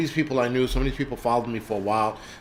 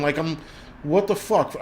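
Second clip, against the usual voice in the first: normal talking voice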